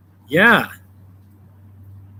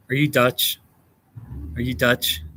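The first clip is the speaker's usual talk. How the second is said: normal talking voice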